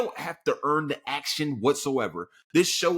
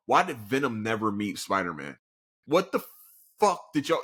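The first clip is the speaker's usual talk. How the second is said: normal talking voice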